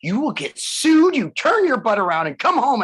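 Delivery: wife ordering him home, mock stern and loud; mock scolding wife.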